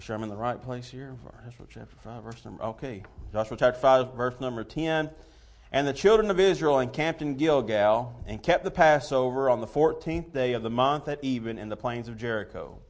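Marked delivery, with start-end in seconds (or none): 5.7-12.8 scripture reading. Real, not acted. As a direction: read the King James verses with weight. Reads King James scripture aloud.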